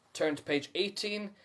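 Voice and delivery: ordinary delivery, nothing marked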